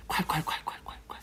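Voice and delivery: whispered pouring sound; breathy gush of water. Pretend.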